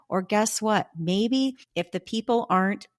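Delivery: ordinary talk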